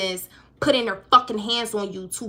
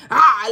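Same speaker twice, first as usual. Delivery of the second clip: loud open-mouthed "ah"; a louder burst, imitating a cat; cat's warning cry; 9 dB louder than usual. Cat opening up at someone, loud warning cry. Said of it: "this is like a cat speaking".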